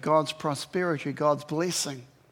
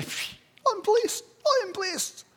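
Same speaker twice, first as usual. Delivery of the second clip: high, light, airy "I'm blessed"; mock blissful voice. Preacher voicing a chained prisoner's blissful relief. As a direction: float up into a dreamy sigh of contentment. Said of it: "How can you say".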